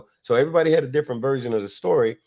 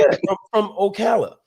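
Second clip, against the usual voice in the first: amazed exclamation; 8 semitones higher, 5 dB louder than usual. Real, not acted. Reacting to the three hours.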